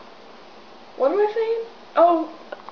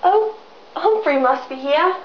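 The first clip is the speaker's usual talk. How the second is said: ordinary talk, nothing marked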